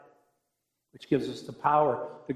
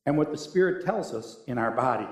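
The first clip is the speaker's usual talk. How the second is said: usual speaking voice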